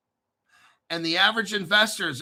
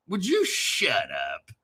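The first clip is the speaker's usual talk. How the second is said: mock second voice; 6 semitones lower than usual. Alter ego snaps back.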